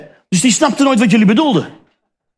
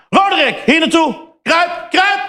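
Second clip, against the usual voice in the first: mock hazing commands; 8 semitones higher than usual. Student orders the freshman to crawl, hammed-up shout. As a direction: boss the freshman around like a dog.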